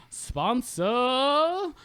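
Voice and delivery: high, loud, long-held word; showy announcer flourish. Acted. Host throwing to the sponsor break, hyped-up flourish. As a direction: stretch it out, climbing high and loud.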